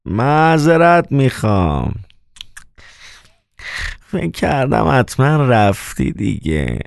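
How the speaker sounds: slow, high, drawn-out grumble; grumbling. A narrator's reading. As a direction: grumble an apology, stretching the words out. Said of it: "قرولند کرد".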